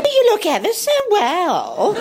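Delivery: high mimicked shop girl, laughing through it; imitating shop girl. Shop girl complimenting the actress, mimicked high. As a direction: coo the compliment like the girl did.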